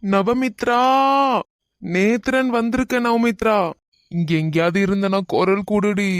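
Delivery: loud calling out. Acted. Nethran telling missing friend he came, calling loudly. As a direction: call out so the missing one can answer. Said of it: "எவ்வளோ சத்தம் போட்டு கூப்பிடுறேன்".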